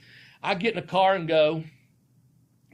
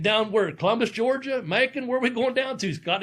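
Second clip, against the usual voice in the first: high, bewildered questions; puzzled; 6 semitones higher than usual. Retold or imitated. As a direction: rattle off places in confusion. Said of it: "cause I'm thinking".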